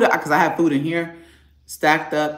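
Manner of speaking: normal talking voice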